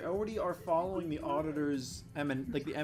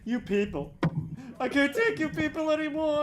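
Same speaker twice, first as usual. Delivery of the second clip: mock exasperation; 11 semitones higher, 7 dB louder than usual.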